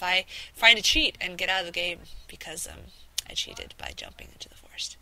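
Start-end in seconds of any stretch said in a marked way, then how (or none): 2.7-5.0 whisper. Real, not acted. Whispered aside to viewers.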